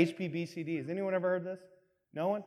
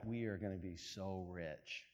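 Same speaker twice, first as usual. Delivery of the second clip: hushed scheming; 10 semitones lower, 18 dB quieter than usual. Retold or imitated. Scientists share a secret look.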